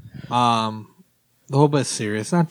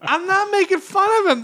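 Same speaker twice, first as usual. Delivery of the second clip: higher-pitched defensive protest; 14 semitones higher than usual. Real, not acted. Host defending his impersonation to co-hosts, protesting. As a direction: insist in a high voice, mock-indignant.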